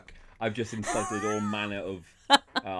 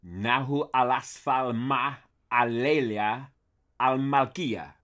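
slow, louder mangled Arabic; 3 semitones higher, 4 dB louder, slower than usual. Shown as a demonstration. Arabic, admittedly mangled, for Jerry. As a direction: murder some Arabic slowly. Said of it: "I'm gonna murder some Arabic".

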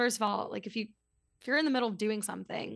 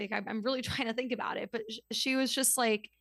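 usual speaking voice

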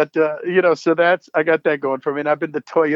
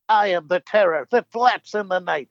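Darkwing Duck catchphrase; 4 semitones higher than usual. Shown as a demonstration. Trying another character on the fan, Darkwing Duck's boast. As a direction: announce yourself like a caped cartoon hero. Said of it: "And I went".

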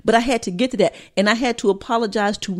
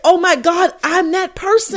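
shocked realization; 9 semitones higher than usual. Retold or imitated. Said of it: "I'm like".

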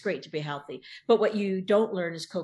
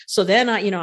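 ordinary delivery

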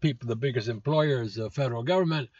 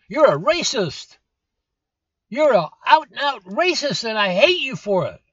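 mock confrontation; 8 semitones higher, 7 dB louder than usual. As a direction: fake telling off a movie star. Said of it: "Nah, I never said that".

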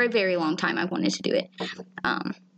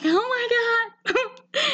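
overwhelmed squeal; much slower than usual. Retold or imitated. Retelling her reaction to compliment.

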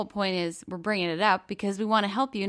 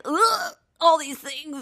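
drawn-out, higher dread sigh; overwhelmed groan; 8 semitones higher, 4 dB louder, slower than usual. Retold or imitated. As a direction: sigh it out like it's all too much. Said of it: "I start to feel like".

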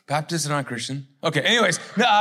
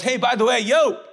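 high, raised-voice announcement; calling out loud; 5 semitones higher, 4 dB louder than usual. Acted. Dad interrupting a private conversation, calling out loud. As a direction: call out for everyone to hear, building higher. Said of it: "he says it out loud".

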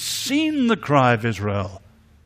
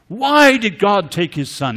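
a mock outraged cry, louder and higher; imitating furious cry; 7 semitones higher, 8 dB louder than usual. Grieving father raging at God over his son: mock furious cry. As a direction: voice the grieving father's rage, half-acted. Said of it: "He was furious".

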